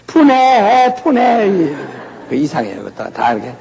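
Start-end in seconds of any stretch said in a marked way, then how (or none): 0.1-1.5 high, slow wailing 분해; mock ghost wail. Preacher mimics a Seoul ghost, high slow wail. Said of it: "서울은".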